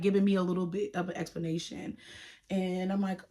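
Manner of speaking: normal talking voice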